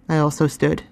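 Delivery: ordinary delivery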